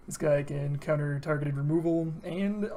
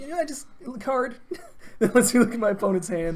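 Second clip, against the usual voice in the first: laughing; 7 semitones higher, 7 dB louder than usual. Real, not acted. Laughing through the card text.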